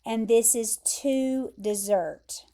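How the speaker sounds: "desert" as duh-ZERT, stressed at the end; to desert, the verb. Shown as a demonstration. Teacher recapping the verb, "desert" stressed on ZERT. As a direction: say "desert" like dessert, landing on ZERT. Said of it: "pronounce it like this word".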